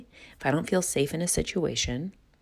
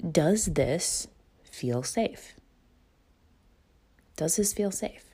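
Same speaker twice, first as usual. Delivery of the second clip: gentle self-question; 2 semitones higher, slower than usual. Retold or imitated. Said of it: "simply asking yourself".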